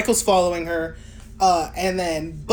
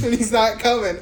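normal talking voice

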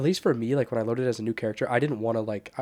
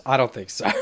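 laughing; 3 semitones higher, 9 dB louder than usual. Real, not acted. Laughing at coughing friends.

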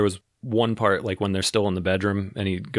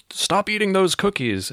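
high, stern parent voice; scolding parent; 11 semitones higher than usual. Retold or imitated. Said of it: "the parents would be like".

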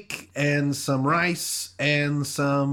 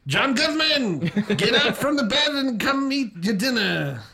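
a high-pitched Roseanne, calling across the house; Roseanne impression; 8 semitones higher than usual. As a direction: call him to dinner like Roseanne. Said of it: "can you do Roseanne?".

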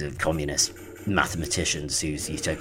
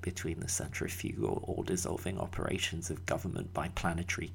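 quiet; 9 dB quieter than usual. Acted. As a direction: mutter the grim list softly. Grim litany muttered.

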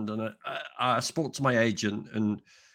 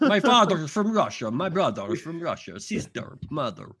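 mock Russian accent; 4 semitones higher than usual. Jokey Russian character bit.

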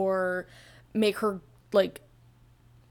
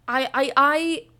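real stumble on the first word; stuttering; 5 semitones higher, 6 dB louder than usual. Real, not acted. Reader stutters really bad starting her opinion, stuttering. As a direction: trip over the opening word, louder and higher. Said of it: "I just stuttered really bad".

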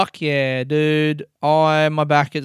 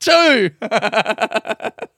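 laugh; 7 semitones higher, 5 dB louder than usual. Real, not acted. Laughing after own yell.

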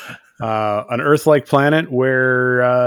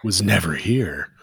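low, soft, slow deadpan; Kirk's grave aside; 6 semitones lower, 7 dB quieter, slower than usual. Retold or imitated. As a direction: deliver it as an ominous closing line. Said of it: "looks in the camera and he says".

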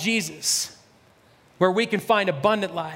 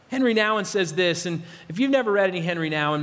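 ordinary delivery

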